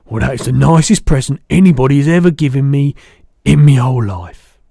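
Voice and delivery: loud, gushing giant's thanks; delighted cry. A narrator's reading. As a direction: cry it out, moved and thrilled. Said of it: "cried the B F G".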